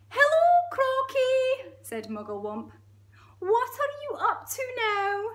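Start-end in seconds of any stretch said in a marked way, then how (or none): 0.1-1.8 very high, loud, slow squeal; monkey voice. Acted. Monkey greeting the crocodile, high cheery squeal. 3.4-5.2 very high, loud, inquisitive squeak; monkey voice. Acted. Monkey quizzing the crocodile, high squeaky voice.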